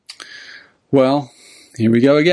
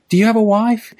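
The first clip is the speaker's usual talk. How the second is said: high, gentle questioning voice; little old man; 10 semitones higher than usual. Retold or imitated. Old man asks tramp about family, high gentle voice. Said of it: "And the little old man goes".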